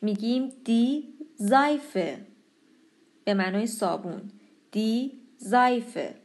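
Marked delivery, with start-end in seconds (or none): 0.6-2.2 slow زایفه with an open ai glide. Shown as a demonstration. Teacher demonstrating vowel pair, زایفه with ai. 4.7-6.1 slow زایفه with an open ai glide. Shown as a demonstration. Teacher demonstrating vowel pair, زایفه with ai.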